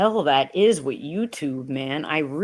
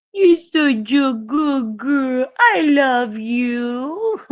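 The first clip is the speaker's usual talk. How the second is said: doting pet talk; 5 semitones higher, much slower than usual. Real, not acted. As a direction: stretch out the words like cuddling a puppy. Cooing at her dog.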